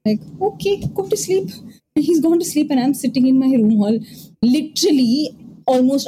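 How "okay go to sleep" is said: high exasperated okay. Retold or imitated. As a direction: give up, fed up. Giving up on dad.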